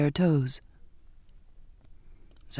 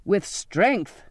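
urging; 3 semitones higher than usual. A narrator's reading. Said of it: "the captain urged".

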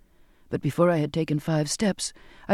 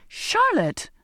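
a sharp, high angry cry of the name; angry outburst. A narrator's reading. Cutting in angrily on the sailor's news, an angry high cry. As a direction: snap the name out, much higher. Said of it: "I broke in angrily".

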